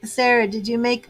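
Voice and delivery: normal talking voice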